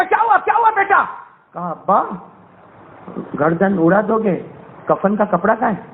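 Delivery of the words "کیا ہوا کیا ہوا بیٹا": alarmed father. Acted. Father startled by son.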